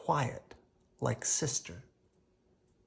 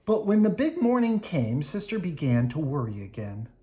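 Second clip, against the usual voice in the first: ordinary delivery, nothing marked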